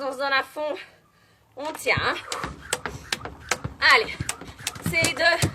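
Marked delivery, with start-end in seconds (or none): 3.9-5.5 raised, pushing voice; loud coaching. Real, not acted. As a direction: drive them on, loud and high. Coach urging class during jump rope.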